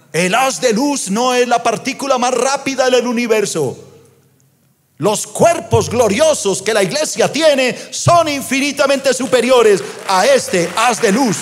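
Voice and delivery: high, drawn-out preacher proclamation; impassioned preaching. Acted. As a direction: proclaim it to the congregation, pitched high. Preaches at full force.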